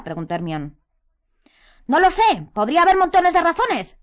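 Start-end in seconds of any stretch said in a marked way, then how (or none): 1.9-4.0 high, loud, exasperated shouting; angry shout. A narrator's reading. Harry answers Hermione's question, shouting. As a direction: shout it in frustration. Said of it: "le gritó Harry".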